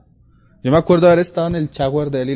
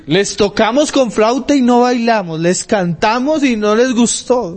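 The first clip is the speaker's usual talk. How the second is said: high, slow preaching lilt; preacher voicing Jesus' words with zeal; fervent scripture quote; 9 semitones higher, 4 dB louder than usual. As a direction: sing out the parable to the church.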